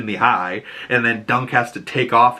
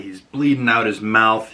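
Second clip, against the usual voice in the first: normal talking voice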